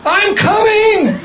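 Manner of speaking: high, slow called answer; called reply. Retold or imitated. Admiral answering the devotee across the parade, a high drawn-out call. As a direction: call it back happily over the crowd.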